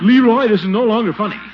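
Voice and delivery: stern scolding. Acted. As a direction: put your foot down with the nephew. Uncle scolding nephew.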